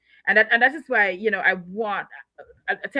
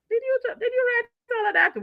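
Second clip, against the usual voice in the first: high, incredulous question to herself; amazed disbelief; 12 semitones higher than usual. Retold or imitated. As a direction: marvel at her own words, way up high. Reading her own book, amazed.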